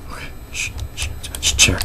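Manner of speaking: soft unvoiced shushing; shushing whisper. Real, not acted. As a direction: hush the noisy chair. Shushing a noisy chair.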